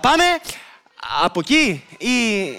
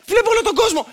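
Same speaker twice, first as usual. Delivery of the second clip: high, delighted exclamation; excited wonder; 8 semitones higher than usual. Acted. Pilot looking through the telescope at everyone, excited wonder. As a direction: marvel at the view.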